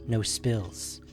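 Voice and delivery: usual speaking voice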